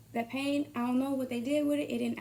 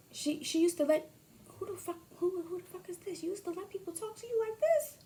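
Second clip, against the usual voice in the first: indignant shrill caller; 8 semitones higher than usual. Pretend. Acted indignant caller, voice cracking.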